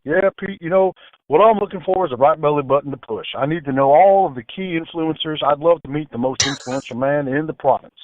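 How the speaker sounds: lightly put-on Texas officer; Texas accent. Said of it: "I like to do a Texas accent".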